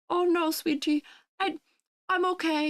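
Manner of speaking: high-pitched, frail little grandma voice. Acted. Grandma reassuring her grandchild from the corner, high little-old-lady voice. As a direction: play a grandma politely declining help. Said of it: "your little grandma who's sitting in the corner".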